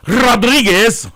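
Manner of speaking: Rodriguez rolled slow and loud, full Spanish flair; full-on Spanish announcer. Retold or imitated. Mocking announcers who switch accent, loud slow Spanish Rodriguez. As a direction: belt Rodriguez like a sportscaster going full Spanish. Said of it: "announcers that are like full on".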